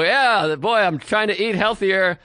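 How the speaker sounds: hammed-up casual declaration; mock enthusiasm. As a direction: sarcastically act out the expected small talk. Mock example of expected talk.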